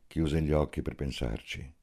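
ordinary delivery

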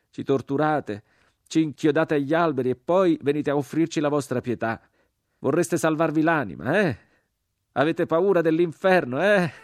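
high, loud, indignant tirade; jeering shout. A narrator's reading. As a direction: taunt them with rising scorn. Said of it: "gridò l'uomo crocifisso".

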